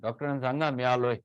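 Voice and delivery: calling out loudly for the doctor; loud patient call. Retold or imitated. Patient shouting for the doctor at reception, loud. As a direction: announce yourself loudly at the desk. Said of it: "They talk like this".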